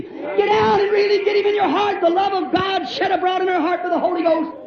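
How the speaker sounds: full-force revival preacher; impassioned preaching. Real, not acted. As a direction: cry it out to the congregation, stretching line endings.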